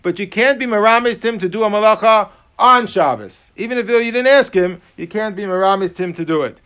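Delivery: normal talking voice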